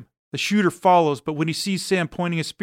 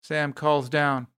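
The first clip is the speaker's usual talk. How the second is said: plain reading voice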